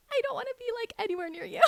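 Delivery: loud, very high comic protest; high-pitched mock aversion. Wife recoiling from her husband nearby, high mock protest. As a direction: squeal it like you can't bear them. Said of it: "but I'm like".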